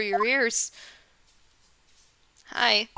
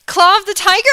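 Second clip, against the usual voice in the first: surprised exclaim; 6 semitones higher, 10 dB louder than usual. Real, not acted. Surprised reading of CD title.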